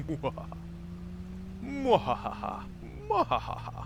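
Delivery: mock villain laugh rising high; evil cackle. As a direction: cackle like a cartoon villain, climbing.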